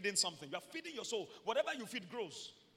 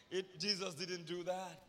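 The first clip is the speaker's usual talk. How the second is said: usual speaking voice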